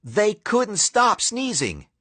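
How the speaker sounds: ordinary delivery, nothing marked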